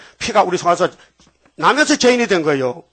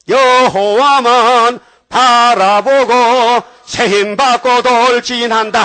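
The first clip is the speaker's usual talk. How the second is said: loud, very slow, drawn-out charge toward the giant; battle cry; 5 semitones higher, 6 dB louder, much slower than usual. Acted. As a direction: bellow it like a boy charging a giant. David charging Goliath, cried out. (여호와만 바라보며 크게 외치는 돌진)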